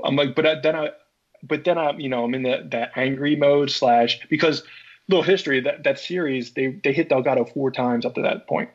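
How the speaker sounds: ordinary talk, nothing marked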